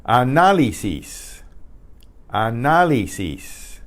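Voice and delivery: Análisis as ah-NA-lee-sees, NA punched; careful teacher's Análisis with a stressed second syllable. Shown as a demonstration. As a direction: model Análisis in Spanish, leaning on NA. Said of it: "that's where the str".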